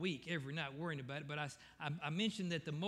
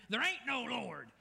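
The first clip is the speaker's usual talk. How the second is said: high, raised yell of a grumpy neighbour; mock yell back; 6 semitones higher, 3 dB louder than usual. Atheist neighbour yelling back at the old lady, high. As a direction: yell it back over the fence. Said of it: "he'd yell back at her".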